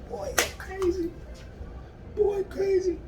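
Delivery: soft little squeaky voice; high-pitched aside. Real, not acted. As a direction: squeak it quietly, in playful amazement. Playful aside about the dog.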